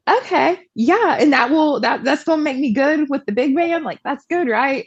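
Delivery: high-pitched, imitated eager agreement; anxious hopeful kid. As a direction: imitate a nervous kid asking for approval. Anxious child seeking preacher's reassurance.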